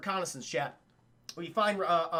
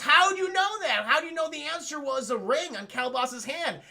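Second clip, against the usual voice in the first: much higher, louder, astonished; mock amazement; 6 semitones higher, 4 dB louder than usual. Andromeda astonished Perseus solved the riddle, high and amazed. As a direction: gasp it out in disbelief.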